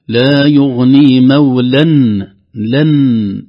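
loud, slow chant with a clear n ending مولا; clear tanween. Shown as a demonstration. Teacher showing the tanween, مولا recited with clear final n. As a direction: sound the hidden n of مولا fully, "mawlan". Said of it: "إذن نون التنوين ستظهر".

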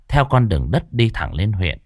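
plain reading voice

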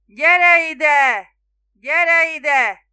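very loud, high bellow; full-force roar. A narrator's reading. Spiro summoning the boatman across the bay, roaring. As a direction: roar it out to carry over water. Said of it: "Spiro újfent ordított".

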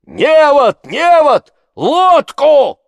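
high, full-voiced calls; shouting. A narrator's reading. Villagers call for net and boat, shouting. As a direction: yell orders across the water. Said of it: "кричали другие голоса".

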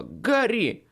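sharp warning shout of a name. A narrator's reading. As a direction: call out the name in alarm. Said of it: "она вдруг крикнула".

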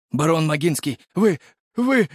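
high, stammering address; flustered alarm. A narrator's reading. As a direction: stammer at the baron in shock. Shaken guard addresses baron. (взволнованно, запинаясь, высоко)

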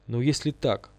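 usual speaking voice

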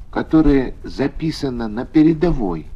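ordinary delivery

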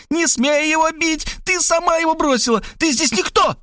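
put-on high girlish voice yelling in defence. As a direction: shout to protect him, high-pitched. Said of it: "завопила". (высокий девичий вопль)

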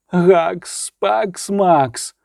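lightly wailed incantation; wailing. A narrator's reading. Reynevan waving his arms through a mock exorcism, wailing. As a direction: chant it out with a touch of a wail. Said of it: "завив він".

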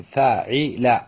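فاعل as drawn fa-'i-la; middle vowel I. Shown as a demonstration. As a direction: sound out فاعل with an I after the ayn. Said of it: "بكسر".

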